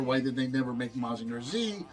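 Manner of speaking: ordinary talk, nothing marked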